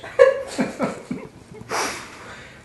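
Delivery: unvoiced high giggle; breathy laugh. Real, not acted. Breaks up reading hate mail.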